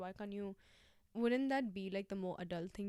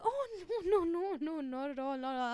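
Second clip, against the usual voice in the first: higher, louder, laughing, over-eager denial; flatmate's laughing denial; 7 semitones higher, 4 dB louder than usual. Retold or imitated. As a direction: brush it off with a nervous laugh. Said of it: "He's like".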